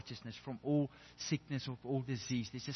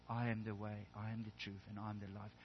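much softer and lower, tenderly answering; gentle, soft Jesus; 4 semitones lower, 11 dB quieter than usual. Acted. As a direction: say it softly to frightened disciples. Jesus answers his disciples gently.

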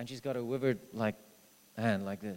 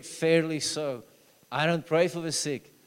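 ordinary talk, nothing marked

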